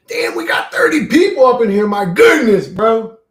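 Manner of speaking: high, loud delight at viewer count; thrilled about thirty viewers; excited. Real, not acted.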